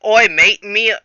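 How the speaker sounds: loud mock Australian greeting; a quick hammed-up Aussie oi; Australian accent. Jack greets the minions in a mock Australian accent. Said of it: "no, that's Australian".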